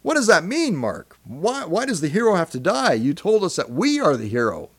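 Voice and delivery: high-pitched, puzzled protest from a listener; questioning listener. Acted. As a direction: challenge the host as a baffled listener. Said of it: "I can hear you asking".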